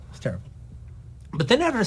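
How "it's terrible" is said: "It's terrible" repeated much softer, under the breath; a quiet afterthought repeat; softer echo. Retold or imitated. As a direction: let the second "It's terrible" fall away quietly.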